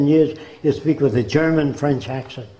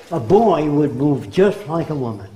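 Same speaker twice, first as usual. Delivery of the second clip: usual speaking voice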